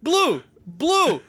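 high, drawn-out shouted callout; hammed-up gamer callout; mock callout. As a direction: yell the color at your squad. Mimicking gaming callouts.

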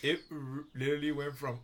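ordinary delivery

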